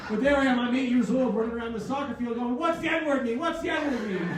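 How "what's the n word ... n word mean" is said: put-on childish asking. Kid running around asking.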